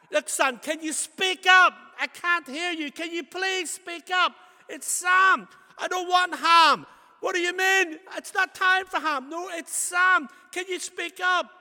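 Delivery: high, full-volume comic hollering; hard-of-hearing shouting match. Acted. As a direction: bellow back and forth with a deaf old aunt. Shouted exchange with deaf old aunt.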